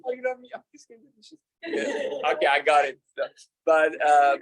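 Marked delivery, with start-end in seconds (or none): 0.0-1.4 lightly voiced high wife, fading soft; a hint of the wife waving off sweet talk; soft high mock wife; French wife. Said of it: "She's like".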